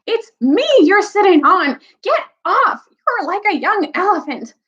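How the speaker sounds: high-pitched, exasperated complaint; angry. A narrator's reading. Dwarf is being sat on by a boy, angry. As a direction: squawk indignantly at the offender. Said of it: "came an angry voice".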